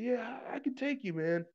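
high-pitched imitation of a man squaring up; mock challenger. Retold men squaring up to fight.

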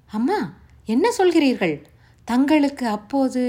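put-on gasping disbelief from a young girl; shocked young Savitri. A narrator's reading. Savitri stunned that her elder could not read, high and loud.

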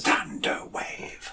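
long, drawn-out breath of a whisper; dramatic whisper. Acted. Player reads the spell name aloud, in a slow dramatic whisper. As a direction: whisper it very slowly, word by word.

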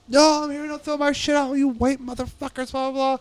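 imitating an angry homeless man yelling, pitched high; retold yell at the volunteers, pitched well up; mock yell. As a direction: play the furious guy who barges in, half-hammed. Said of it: "And he's yelling shit".

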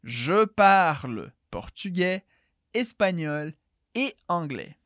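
"je parle portugais, espanhol e anglais" said slowly with pauses between the items; slow it down and pause after each language, formally. Shown as a demonstration. Teacher shows the formal, unhurried way to say "je parle portugais". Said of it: "mais formal, mais pausada".

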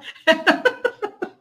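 high-pitched burst of laughter; real laugh. Real, not acted. Se ríe al contar la anécdota. (risa real y aguda)

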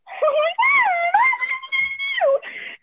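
high, drawn-out comic wailing cry; long hammed-up sob, very high; mock wail. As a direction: wail like a melodramatic breakdown. Mimes her own wailing reaction.

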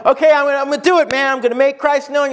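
mock pep talk, pitched high; acted burst of determination; gung-ho resolve. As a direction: psych yourself up, high and eager.

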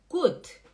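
gut ending on a plain t; high, emphatic German gut; clean final T. Shown as a demonstration. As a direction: say "gut" as goot, nothing after the t. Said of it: "Não é Gucci".

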